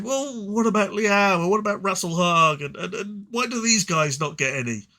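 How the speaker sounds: hammed-up fan whinging, slowing on the first question; whiny complaining fans. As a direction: mimic the fans pestering for their faction. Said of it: "And then everyone said".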